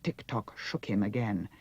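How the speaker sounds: ordinary delivery, nothing marked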